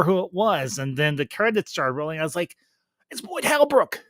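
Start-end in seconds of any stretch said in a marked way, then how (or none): 3.1-4.0 high-pitched retold burst of recognition; excited realization. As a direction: act out suddenly placing the actor. Retelling his moment of recognition.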